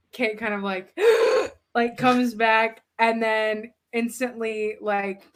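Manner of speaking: normal talking voice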